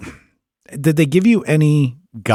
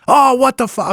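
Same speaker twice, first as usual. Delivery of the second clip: high mock yell at the screen; yelling at a bad live feed; 13 semitones higher, 3 dB louder than usual. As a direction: shout it up at the monitor in disbelief. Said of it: "I'm, like, yelling at the screen".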